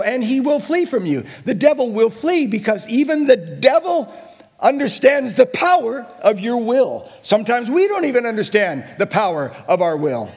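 high, loud building preacher's cry; preaching at full force. Acted. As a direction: rise and drive it home to the room.

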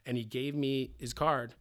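ordinary talk, nothing marked

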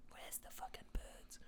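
slow, hushed cat demanding birds; whispered cat voice. Retold or imitated. Voicing a newborn cat hunting birds, slow whisper.

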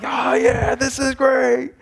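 high, hammed-up cheer of "Oh, yeah"; a playful celebration among friends; mock high-five cheer. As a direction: disciples high-fiving with a light mock cheer. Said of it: "maybe they were high-fiving".

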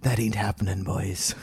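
low threat from a big man; menacing big guy. Retold or imitated. Scottish guy holding both friends, quietly warning them off in a low voice.

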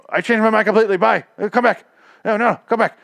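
overacted, pleading come-back cry; mock frantic flip-flop. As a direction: parody her changing her mind in a rush. Mocking a character's flip-flop.

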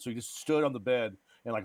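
ordinary talk, nothing marked

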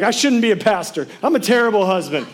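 hammed-up panic of a sleepless husband; panicked spiral. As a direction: melodramatic self-pity. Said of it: "And I'm like".

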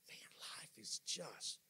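slow, whispered and weary; hushed, dragging lament; worn-out murmur. Acted. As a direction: act drained, almost no voice left. Voicing someone worn out.

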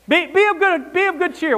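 rally frightened sailors in the storm; Paul urging the crew mid-storm, insistent; urgent, insistent encouragement. Acted. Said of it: "What does he say?".

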